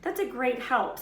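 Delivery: loud, high sarcastic snarl. A narrator's reading. Ron snarls at Hermione, loud sarcastic snarl. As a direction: bite back sarcastically. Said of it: "snarled Ron".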